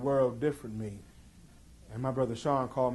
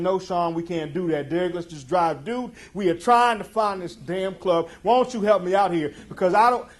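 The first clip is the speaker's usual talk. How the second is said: loud urgent pleading, pitched up; pushing back on brother; 6 semitones higher, 11 dB louder than usual. Retold or imitated. To his brother in the car, a loud frantic plea. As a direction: shout him down from the back seat. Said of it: "I'm pushing back on the seat saying".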